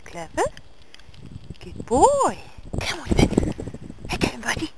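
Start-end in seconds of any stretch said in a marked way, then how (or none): none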